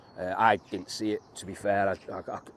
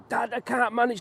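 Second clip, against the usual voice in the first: put-on high, strained little-boy plea; a young lad begging his dad for help; pleading kid voice; 6 dB louder than usual. Retells struggling with a fish.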